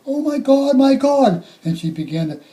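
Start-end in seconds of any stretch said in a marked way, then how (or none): 0.1-1.4 high, loud cry of shock; retold scream. Mother finding her boys, screaming in shock. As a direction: scream it out in horror. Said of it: "She starts to scream".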